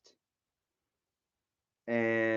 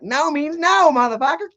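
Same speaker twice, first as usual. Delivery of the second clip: mock wife yell; 13 semitones higher, 3 dB louder than usual. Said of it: "she was like".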